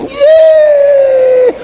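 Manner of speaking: high cheering yell; joyful shout. Retold or imitated. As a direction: cheer out loud with relief. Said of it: "dia bilang".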